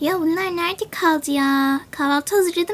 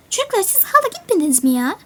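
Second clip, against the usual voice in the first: higher, fast scolding mother; exasperated mother; 6 semitones higher, faster than usual. Acted. Mother scolding the kids who haven't left yet, exasperated and fast. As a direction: scold the kids for still dawdling.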